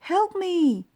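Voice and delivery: high, loud desperate call; crying out. A narrator's reading. Old mouse running home to his wife, loud high cry. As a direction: call for help as you run in. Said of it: "ran home and cried".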